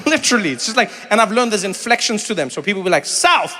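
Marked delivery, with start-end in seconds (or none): 3.0-3.6 "South," pitched high and falling; local inflection on South. Retold or imitated. Locals naming their area, high falling "South,". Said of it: "they say it like that".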